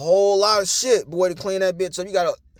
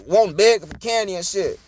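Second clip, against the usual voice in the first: ordinary talk, nothing marked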